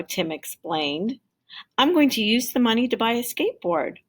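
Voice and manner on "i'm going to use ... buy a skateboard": put-on quick, bright little boy; child's voice. A narrator's reading. Tim telling Jamal his birthday plan, fast child voice. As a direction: rush it out like an eager kid. Tim explains to can man.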